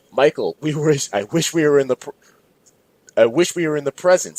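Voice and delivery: high, loud tactless scream. Acted. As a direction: yell the wish at full volume. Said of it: "screamed Darnell with zero tact".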